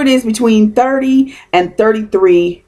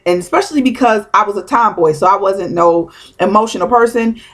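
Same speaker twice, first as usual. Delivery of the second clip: normal talking voice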